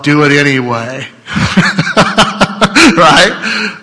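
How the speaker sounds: mock booming voice of God, laughing at the end; hammed-up divine command; here's what God says voice. Shown as a demonstration. Pastor to church, a playful mock God voice. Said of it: "That's my best, here's what God says voice".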